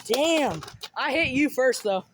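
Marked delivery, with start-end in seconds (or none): none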